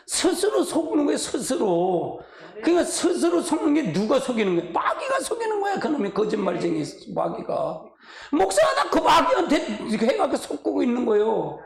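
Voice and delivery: usual speaking voice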